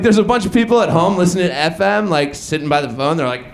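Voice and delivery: usual speaking voice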